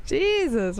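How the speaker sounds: ordinary talk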